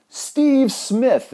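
high, loud ringing announcement; court announcer. Retold or imitated. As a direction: proclaim the name like a court herald. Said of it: "announce everybody in".

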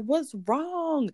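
high-pitched, retold alarm; upset. Mama Rita answers her hysterical call, upset. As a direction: mimic an upset loved one asking. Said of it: "she was upset".

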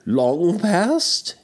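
put-on high, curious old miser; Scrooge voice. A narrator's reading. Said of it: "inquired Scrooge".

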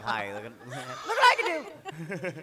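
high, loud and playful; showing off like a kid. Retold or imitated. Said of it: "really, like".

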